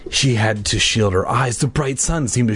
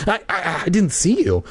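stammering; 9 semitones higher than usual. Acted. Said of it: "Hannah stammered".

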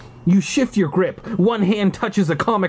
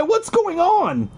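high, slow scared question; panicked; 14 semitones higher, slower than usual. A narrator's reading. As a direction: plead in confusion. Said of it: "you cry".

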